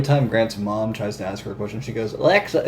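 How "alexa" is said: lightly imitated Southern accent, pitched up. Friend's mom calling on the smart speaker, mild imitated Southern accent. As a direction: say it in a slight Southern accent, higher, rising at the end. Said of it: "in her Southern accent".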